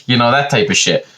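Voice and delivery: ordinary delivery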